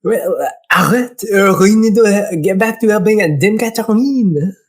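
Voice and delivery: hammed-up French accent, insistent; French-accented scolding. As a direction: urge him on as a Frenchman. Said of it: "He's gonna be French".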